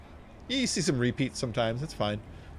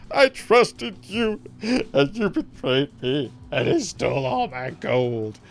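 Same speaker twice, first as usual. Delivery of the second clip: slow, loud, hammed-up bawling; imitated blubbering, words dragged out; mock bawling; 3 dB louder, slower than usual. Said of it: "He's just bawling".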